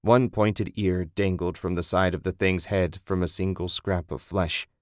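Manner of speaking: ordinary delivery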